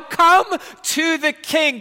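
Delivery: high, loud, slow call; loud plea. Acted. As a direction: call them forward with all your strength.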